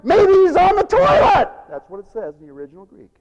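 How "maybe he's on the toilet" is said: high, loud mocking yell; jeering. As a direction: shout the taunt at full pitch. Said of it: "he jeered him".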